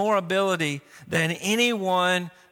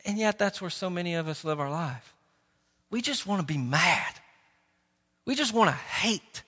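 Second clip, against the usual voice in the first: ordinary talk, nothing marked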